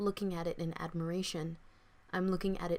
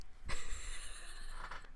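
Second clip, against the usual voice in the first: amused laugh; 4 dB quieter than usual. Real, not acted. Laughs at own whisper.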